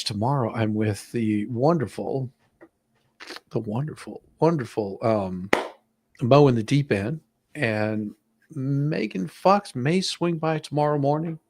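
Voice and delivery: usual speaking voice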